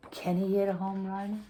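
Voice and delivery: ordinary delivery, nothing marked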